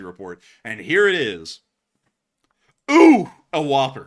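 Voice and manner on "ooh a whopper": loud impressed ooh; big surprised exclamation. Real, not acted. Reacting to big report.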